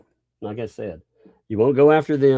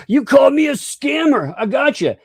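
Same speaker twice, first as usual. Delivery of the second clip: high, loud mock accusation; mock whining yell; 13 semitones higher, 5 dB louder than usual. Streamer imitating a whining critic's accusation, high mock yell. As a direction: yell it up high like a sore loser. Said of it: "He's like".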